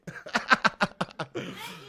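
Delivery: high chuckling laugh; amused, rapid giggling laugh. Real, not acted. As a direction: crack up at a silly comment. Laughs at viewer's comment.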